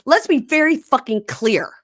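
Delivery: mocking a furious husband storming off; mock furious yell. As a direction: ham up a man yelling he's done. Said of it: "Stands up very mad and says".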